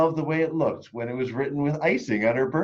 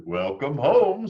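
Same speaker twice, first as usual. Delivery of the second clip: father's welcome; 5 semitones higher, 6 dB louder, slower than usual. Acted. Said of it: "said her father".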